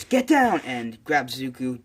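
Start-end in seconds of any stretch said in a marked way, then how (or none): 0.0-0.6 loud, high-pitched urgent yell; shouted warning. Acted. Friend warns of the exploding gauntlet, urgent shout. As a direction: yell it as if it is about to blow.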